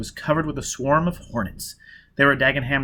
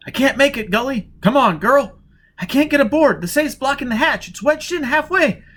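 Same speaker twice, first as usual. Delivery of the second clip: higher, louder desperate woman; desperate Jiz; 13 semitones higher, 6 dB louder than usual. Acted. Jiz telling Gully she can't get aboard, desperate. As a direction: plead with rising panic.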